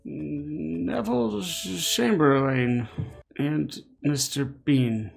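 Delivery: high, theatrical salesman's pitch; hammy British showman. Retold or imitated. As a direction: ham it up like a stage barker. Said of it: "some British stage production".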